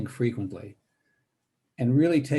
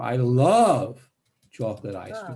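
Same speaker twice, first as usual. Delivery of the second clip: "love" loud and drawn out with a big pitch swell; stress on "love"; 4 dB louder, slower than usual. Shown as a demonstration. Teacher practices emphasizing different words, stress on "love". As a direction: stretch and swell "love", then finish the phrase. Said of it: "emphasizing different words in it".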